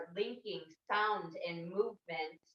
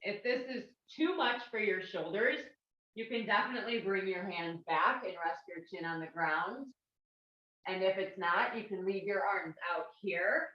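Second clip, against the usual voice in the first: usual speaking voice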